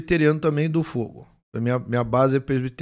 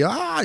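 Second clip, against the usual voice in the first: excited cry; 11 semitones higher than usual. Retold or imitated. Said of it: "Eu comecei a gritar dentro do carro".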